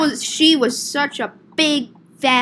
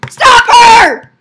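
very high, very loud scream; full shout; 10 semitones higher, 14 dB louder than usual. Acted. Shouting for the customer to be stopped, urgently. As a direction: yell it at the top of the voice.

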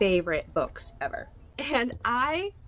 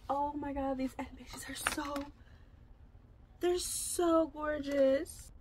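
hushed awe; 6 semitones higher, 6 dB quieter, slower than usual. Real, not acted. Admiring the end pages.

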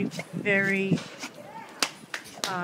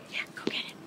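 soft unvoiced whisper; 9 dB quieter, much faster than usual. Real, not acted. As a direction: breathe it to the dog. Urging the dog in a whisper.

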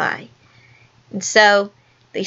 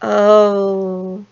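long, level drawn-out moan. A narrator's reading. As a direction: moan it in mock sympathy, held long. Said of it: "they moaned".

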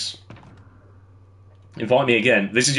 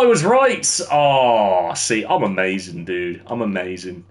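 ordinary talk, nothing marked

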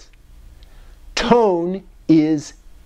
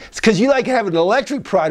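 ordinary talk

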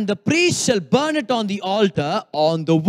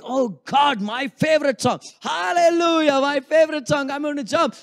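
excited worshipper; 5 semitones higher than usual. Retold or imitated. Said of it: "you're worshiping God and you're saying".

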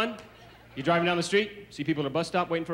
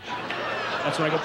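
usual speaking voice

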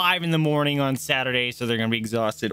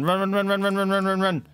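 being chased; 6 semitones higher than usual. Real, not acted. Nope, it's bad.

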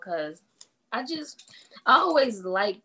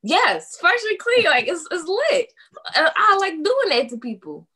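higher, louder, gushing enthusiasm; excited agreement; 8 semitones higher, 4 dB louder than usual. Real, not acted. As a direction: burst out in delighted agreement. Delighted agreement with prompted phrase.

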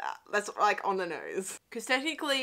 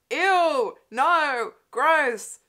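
loud, higher cry of revulsion; disgusted; 5 semitones higher, 6 dB louder, much slower than usual. Real, not acted. Commentator reacting to a sweaty man's touch, disgusted. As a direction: recoil loudly, word by word.

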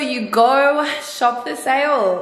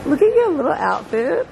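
usual speaking voice

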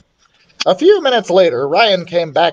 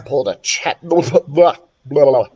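real stumble into babbled syllables; tongue-tied; 2 semitones lower than usual. Real, not acted. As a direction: trip over the word and blabber it off. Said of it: "got tongue-tied for a second".

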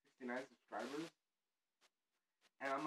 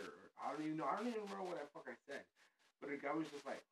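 ordinary delivery

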